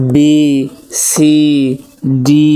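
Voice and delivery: "বি, সি, ডি" each with a long ee, separated; drawn-out letter names. Shown as a demonstration. Teacher drilling the alphabet, "বি, সি, ডি" drawn out. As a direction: stretch the vowel in "বি, সি, ডি". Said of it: "টেনে পড়তে হবে".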